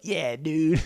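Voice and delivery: high, laid-back surfer-dude drawl; California dude. Retold or imitated. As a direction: play the chill California bro. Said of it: "the way he talks".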